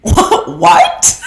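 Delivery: incredulous laughing what; breathy laughing what; laughing disbelief. Retold or imitated.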